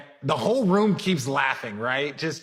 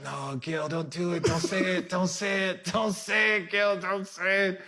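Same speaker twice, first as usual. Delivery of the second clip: softer, urgent "don't say it" under the breath; hushed pleading; 5 dB quieter than usual. Retold or imitated. Teammate silently begging Gil not to joke, a hushed plea. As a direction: quietly beg him to stop. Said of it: "And I was just like".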